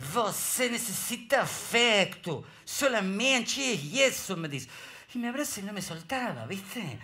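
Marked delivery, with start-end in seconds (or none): 0.1-4.4 Brazilian pastor, tender. Retold or imitated. Comic imitating the pastor embracing him, slow Brazilian accent. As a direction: say it slowly like a hugging pastor.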